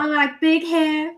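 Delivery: slow, high Texan drawl; exaggerated Southern twang; Southern gal accent. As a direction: draw it out like a Southern belle. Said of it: "the South country".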